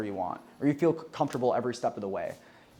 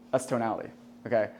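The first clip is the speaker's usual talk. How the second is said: usual speaking voice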